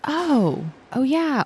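an aha moment, voiced high; realizing. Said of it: "I'm like".

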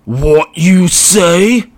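a brutish orc taking his time. Acted. Orc voiced.

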